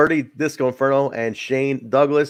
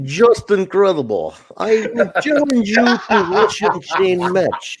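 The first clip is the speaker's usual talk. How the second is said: slow, higher, hammed-up Russian wrestler; mock Russian accent; 8 semitones higher, slower than usual. Imitating Jerry Lynn with a mock Russian accent, slow and high. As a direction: ham up a broken-English Russian challenge. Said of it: "He's like".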